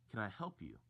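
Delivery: retold question, not rude. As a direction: ask it evenly, without rudeness. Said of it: "Not in a rude way".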